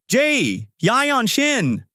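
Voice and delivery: high, drawn-out yell of a name. A narrator's reading. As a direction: yell for an employee like an angry manager. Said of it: "Manager Park yells".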